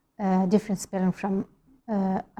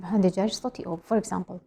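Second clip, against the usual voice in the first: ordinary delivery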